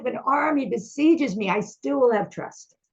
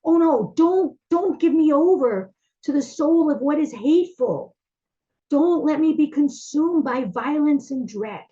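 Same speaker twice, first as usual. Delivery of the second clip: higher, louder desperate begging; desperate plea; 4 semitones higher, 4 dB louder than usual. Acted. Psalmist begging God in panic, desperate. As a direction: beg not to be handed over. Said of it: "And then, like".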